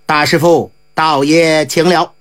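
a lightly voiced elder, low and courteous; bow and greet the monk politely, slowed; old man's greeting. A narrator's reading. Old gentleman greeting the monk, low and courteous.

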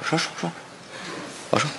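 cowed giving in. Acted. Cornered friend agrees to confess after a beating, cowed and quiet. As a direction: give in meekly under pressure. Beaten friend gives in to questioning.

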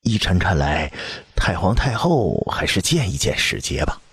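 usual speaking voice

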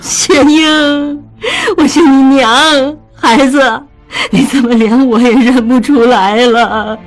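high, tearful plea, slowing on the middle; crying. A narrator's reading. Mother weeps shaking her dazed daughter. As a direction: beg her to know you, weeping. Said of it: "江母摇晃着她哭道".

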